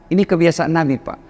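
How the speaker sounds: ordinary talk, nothing marked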